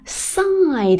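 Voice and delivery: "side" said long and stressed; heavy stress. Shown as a demonstration. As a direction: lean into "side" and draw it out. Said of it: "这个音就读得比较重".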